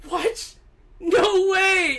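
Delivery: high-pitched retold astonishment; amazed disbelief. Retelling amazed first reaction to a review.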